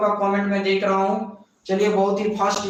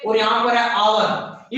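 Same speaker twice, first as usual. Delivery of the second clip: usual speaking voice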